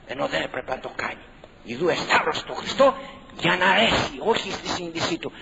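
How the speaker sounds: normal talking voice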